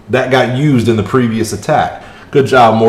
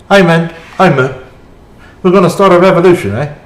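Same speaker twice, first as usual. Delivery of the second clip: high, fast put-on Korg accent, a bit louder; Korg accent attempt; 7 semitones higher, 3 dB louder than usual. Gamer quoting Korg to his stream in a put-on Korg accent. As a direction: rattle off the line in a mock Korg accent. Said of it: "I can't do the accent".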